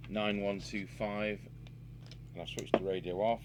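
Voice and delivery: ordinary delivery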